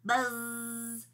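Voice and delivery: long, sustained buzzing z; fly voice. A narrator's reading. A hidden fly answers from above, buzzing. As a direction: hum the z like an insect. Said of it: "He heard a voice from above".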